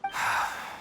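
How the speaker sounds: a tired exhale through the nose; weary sigh. Acted. Doctor sighs at the visitor's transfer request, weary sigh. Doctor asks to move patient.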